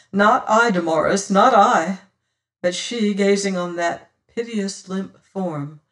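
usual speaking voice

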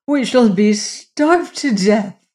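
slow, high, loud wail; crying out. A narrator's reading. Hungry queen to her son in the dungeon, crying out. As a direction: wail it in despair, drawn out. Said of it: "she cried".

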